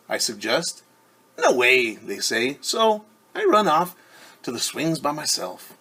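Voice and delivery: lively picture-book read-aloud; reading to children. A narrator's reading. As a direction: read it brightly to a class of kids.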